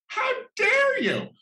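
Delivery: hammed-up royal shriek of offense; mock-outraged Queen. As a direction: clutch your pearls, hammed-up indignant royal.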